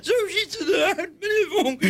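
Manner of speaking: high, laughing and half-crying at once; can't stop giggling, voice cracking; laughing through tears. Real, not acted. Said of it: "laat me nou ook eens een keer lachen". (lachend door tranen heen)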